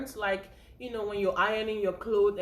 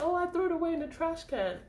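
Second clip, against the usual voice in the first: higher, lightly voiced offhand answer; careless patient; 7 semitones higher, faster than usual. Patient telling the ER nurse the finger is in the trash, higher and offhand. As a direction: toss it off like it's no big deal.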